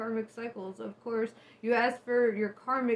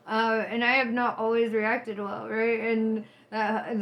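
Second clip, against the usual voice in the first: normal talking voice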